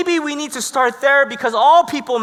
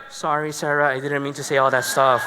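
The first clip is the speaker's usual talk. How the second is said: meek apology; 6 semitones lower than usual. Retold or imitated. Tough guy apologising to his angry girlfriend, meek. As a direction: imitate a sheepish climb-down. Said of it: "the weirdest transformation took place".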